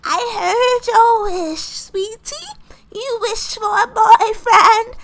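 high, slow fairy godmother voice; odd fairy godmother. Acted. Godmother tells girl she heard her wish, high and slow. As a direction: stretch it out in a high put-on voice. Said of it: "why are you talking like this".

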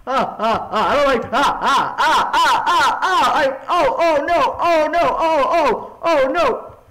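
loud, high repeated cries; panicked yelps. Real, not acted. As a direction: yelp in rising panic. Panicking at the game monster.

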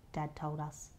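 usual speaking voice

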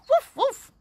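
high, loud mock woofs; dog barking. Teacher shows children a dog, high playful barking. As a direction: bark like an excited little dog.

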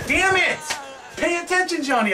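frustrated shout. Real, not acted. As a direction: yell at yourself for slipping up. Scolds himself after dying.

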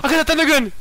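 high, loud squeal of glee. Real, not acted. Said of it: "You sound like a little kid".

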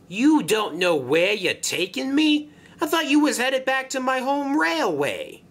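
high, loud protest; indignant Vinny. Acted. Passenger engine protesting to the barge carrying him, indignant. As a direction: demand answers in a high, affronted voice.

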